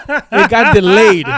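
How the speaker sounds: loud, drawn-out laughing delivery; cracking up while speaking. Real, not acted. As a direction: say it slowly through laughter. Laughing at the delay punchline.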